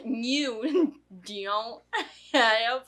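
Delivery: imitating a movie mama's speech impediment; slow, halting words with an odd drawn-out twang; mock the garbled speech, one labored word at a time; mama's impeded speech. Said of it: "That's how she spoke".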